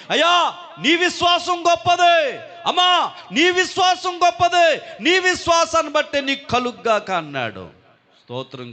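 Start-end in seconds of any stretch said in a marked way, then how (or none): 0.1-7.1 high, loud ringing proclamation; proclaiming shout. Acted. Jesus praising a woman's faith before the crowd, shouting. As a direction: call it out with full force, praising faith.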